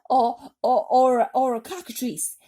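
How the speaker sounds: loud, high stammering yell; a police chief yelling in a fluster. A narrator's reading. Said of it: "yelled the chief of police".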